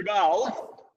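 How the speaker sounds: put-on playful French accent. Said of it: "look at my French accent".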